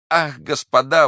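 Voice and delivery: high, loud plea for help; desperate exclamation. A narrator's reading. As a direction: cry out to them in alarm. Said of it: "воскликнул он". (отчаянный высокий возглас)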